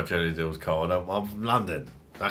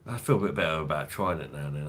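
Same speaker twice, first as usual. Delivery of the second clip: usual speaking voice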